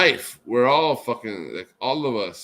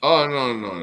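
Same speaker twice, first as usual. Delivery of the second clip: normal talking voice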